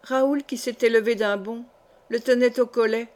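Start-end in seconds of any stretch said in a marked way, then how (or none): none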